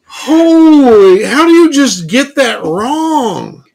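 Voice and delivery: high, drawn-out disbelief; incredulous. Real, not acted. Co-host reacting to the clinic's huge blunder, incredulous. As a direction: stretch it out, high-pitched and appalled.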